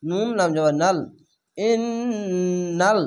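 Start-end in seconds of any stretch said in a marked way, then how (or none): 1.6-3.0 ইন্নাল very slow, the ন্ন held through the nose; nasal ghunnah. Shown as a demonstration. Teacher demonstrating compulsory ghunnah on ইন্নাল. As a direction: hold the ন in ইন্নাল as a long hum. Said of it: "ওয়াজিব গুন্না".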